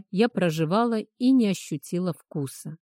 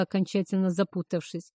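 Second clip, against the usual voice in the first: ordinary delivery, nothing marked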